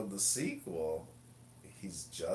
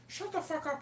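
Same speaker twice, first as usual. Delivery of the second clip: much higher squeaky retort; mocking impression; 3 dB louder than usual. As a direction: snap it back in the silly high voice. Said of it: "He goes".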